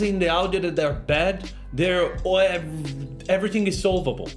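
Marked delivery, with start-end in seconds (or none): none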